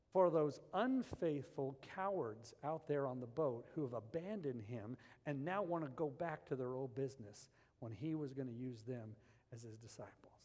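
ordinary talk, nothing marked

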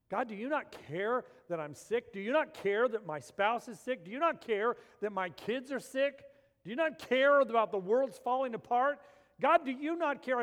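loud, high pleading complaint; complaining cry. Retold or imitated. Believer accusing God in sickness, loud and high.

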